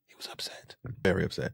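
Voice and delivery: very soft whisper on "He was upset"; whispered aside. Real, not acted. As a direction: drop to a whisper to repeat the verdict. Repeats verdict in a whisper.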